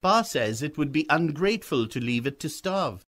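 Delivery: put-on a boy pleading his father's case; persuading young boy.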